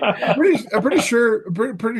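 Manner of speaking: high, laughing mock protest; teasing co-host with a laughing squeal. Real, not acted. Laughing at the co-host's mix-up, high and bright.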